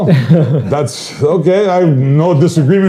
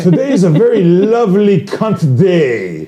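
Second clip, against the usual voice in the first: high, slow mock British forecast; British weather presenter; 5 semitones higher than usual. Host joining in the joke as a British forecaster, mock presenter voice. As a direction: draw it out like a put-on British presenter. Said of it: "if you're British".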